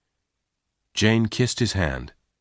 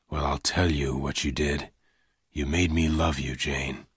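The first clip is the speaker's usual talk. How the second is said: lower, softer, held back; low pained gunman; 5 semitones lower, 6 dB quieter than usual. Acted. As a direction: push her away quietly. Lassiter's bitter confession.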